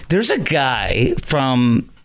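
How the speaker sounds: usual speaking voice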